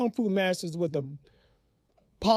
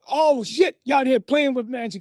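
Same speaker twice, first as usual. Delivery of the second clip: loud, higher-pitched scolding outburst; angry father; 5 semitones higher, 4 dB louder, slower than usual. Retold or imitated. Father finding the matches and fire, angry outburst. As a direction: imitate an alarmed, cursing dad.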